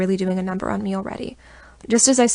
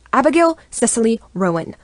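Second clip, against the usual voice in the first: higher, louder blustering full name; 4 semitones higher, 7 dB louder than usual. A narrator's reading. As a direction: scold her by her full name. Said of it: "my grandfather blusters".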